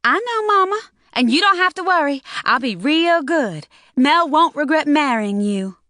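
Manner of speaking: put-on high, bright girlish voice, eager to please; young girl voice. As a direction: play the daughter promising to be good. Teen girl Loretta voiced.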